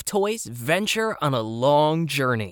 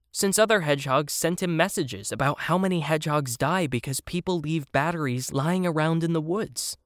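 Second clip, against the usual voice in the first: ordinary delivery, nothing marked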